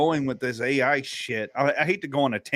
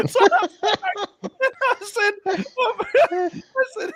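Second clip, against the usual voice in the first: high squeals of laughter breaking the words; can barely get it out for laughing; laughing hard. Real, not acted. Retelling the chatbot names to friends, cracking up.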